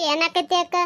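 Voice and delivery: normal talking voice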